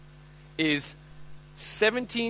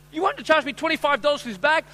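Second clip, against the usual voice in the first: high-pitched outraged haggling; mock-indignant customer; indignant protest; 9 semitones higher, 3 dB louder than usual. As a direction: challenge the seller in disbelief. Acted quarrel over bag's price.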